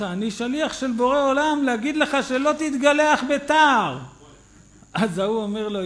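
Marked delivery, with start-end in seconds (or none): 0.0-4.0 stern rebuke. Retold or imitated. Rabbi scolding driver.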